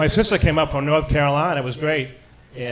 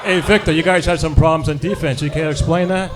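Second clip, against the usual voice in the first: lightly voiced reporter, a bit louder; mock locker-room interviewer calling out a question; sports writer impression; 2 semitones higher, 5 dB louder than usual. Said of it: "here's my one impression".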